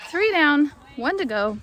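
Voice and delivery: loud triumphant call; excited cheer. Real, not acted. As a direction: celebrate out loud. Cheering after herding success.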